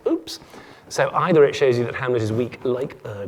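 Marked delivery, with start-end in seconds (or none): none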